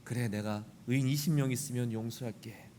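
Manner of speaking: low, solemn voice of God, granting mercy; God answering. Retold or imitated. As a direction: answer as a patient, deep God. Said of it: "의인 이십 명이 있으면 어떻게 하시겠습니까". (하나님의 낮고 너그러운 목소리)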